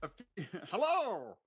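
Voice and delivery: a hint of a squeaky chipmunk; lightly squeaky little hello, laughing. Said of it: "you sound like a chipmunk".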